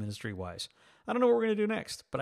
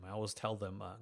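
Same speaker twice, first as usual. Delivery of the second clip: ordinary delivery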